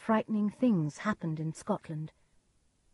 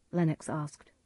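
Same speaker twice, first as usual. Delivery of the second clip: plain reading voice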